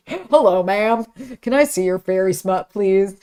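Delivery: hammed-up courteous request; mock polite request. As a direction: play up the polite customer, amused. Replaying bookstore request amid laughter.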